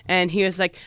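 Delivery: normal talking voice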